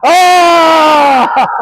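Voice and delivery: long, loud, high cry; excited shout. Real, not acted. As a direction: holler as the action happens. Dramatic moment in zombie game.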